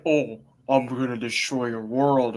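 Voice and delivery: low, slow, creepy villain voice; Galactus. Pretend. Fan guessing how Galactus will talk in the trailer, deep creepy voice. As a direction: deepen it into a slow, creepy threat. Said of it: "Like, in a deep voice".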